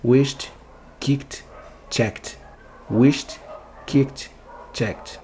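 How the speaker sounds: "Wished", "kicked", "checked" as wisht, kikt, chekt. Shown as a demonstration. Teacher drilling voiceless endings, each verb closed with T. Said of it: "com som de T de tatu".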